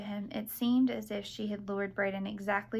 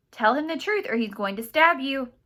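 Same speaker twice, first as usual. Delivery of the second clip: loud, higher, urgent warning; frantic shout; 5 semitones higher, 9 dB louder than usual. A narrator's reading. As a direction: shout as if seconds are left. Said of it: "Serafina shouted frantically".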